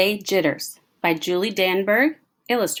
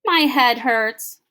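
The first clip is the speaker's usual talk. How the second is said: mild moan; 6 semitones higher, 4 dB louder, slower than usual. Acted. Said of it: "she moaned".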